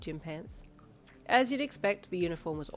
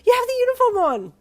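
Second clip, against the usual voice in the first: much higher, loud joyful scream; screaming excitedly; 16 dB louder than usual. A narrator's reading. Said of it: "you screamed with excitement".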